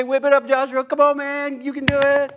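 loud, high hyped-up cheerleading; pep-talk coach. Retold or imitated. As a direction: pump him up like a sports coach. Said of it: "That's not what he said".

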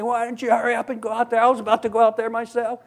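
high, fast scolding; berating buddy. Retold or imitated. Buddy berating the lifeguard, fast and high.

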